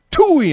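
very high, loud mock spit noise; cartoon spit noise, hammed up; spitting sound. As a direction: blast a spit sound out the window. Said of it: "I just spit at their car".

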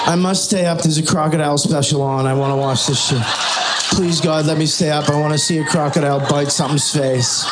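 put-on whiny man-child. Comedian plays himself whining sleepy.

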